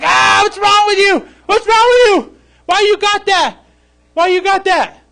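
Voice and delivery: high, loud, slow jeering voice; mocking neighbors. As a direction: sneer at him like a mocking crowd. Neighbors mock Noah's boat.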